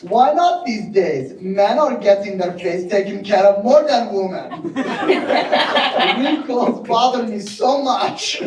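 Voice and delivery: put-on high, laughing Persian accent for his mom. Son reading his Iranian mom's text aloud in a high, giggling Persian accent. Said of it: "And then she said".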